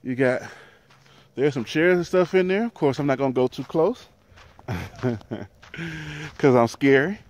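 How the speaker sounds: normal talking voice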